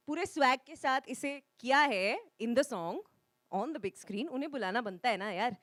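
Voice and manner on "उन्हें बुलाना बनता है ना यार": usual speaking voice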